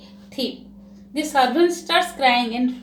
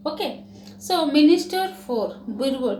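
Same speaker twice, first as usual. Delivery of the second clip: ordinary delivery, nothing marked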